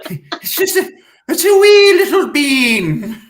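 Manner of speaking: high, loud Irish lilt, laughing through it; hammed-up Irish accent on wee little bean; mock Irish teacher. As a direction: play the silly Irish teacher with a giggle. Continuing mock Irish sex ed.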